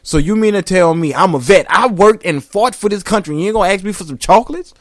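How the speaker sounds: put-on loud offended old vet; indignant outburst.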